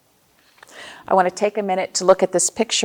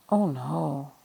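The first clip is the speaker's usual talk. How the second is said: soft mock dismay; 4 semitones lower, 10 dB quieter than usual. Button lost in story.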